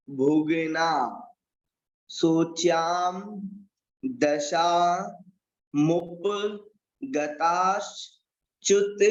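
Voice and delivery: slow word by word Sanskrit with long pauses; teacher splitting the verse into words; word by word recitation. Shown as a demonstration. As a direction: dictate each word of the verse slowly. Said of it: "प्रारंभ करते हैं उच्चारण करने के लिए".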